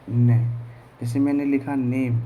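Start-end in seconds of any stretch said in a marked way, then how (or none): 0.1-0.4 ने said loud and firm; teacher answering ने loudly; stress on ने. Shown as a demonstration. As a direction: punch the sound ने for students. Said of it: "एन का उच्चारण हमने क्या किया".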